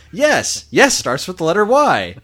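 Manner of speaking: sing-song letter game like a puppet; Muppet voice. Retold or imitated. Muppet answers Doc with letters, high puppet voice. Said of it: "Ernie and Bert".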